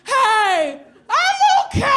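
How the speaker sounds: put-on very high-pitched drunken yelp. Drunk woman announcing from the stall, high mock shriek. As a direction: call it out shrieking to the whole room. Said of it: "I just said out loud to no one".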